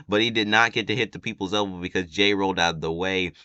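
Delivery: usual speaking voice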